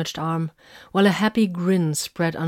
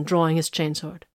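usual speaking voice

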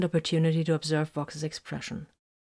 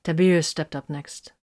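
ordinary delivery

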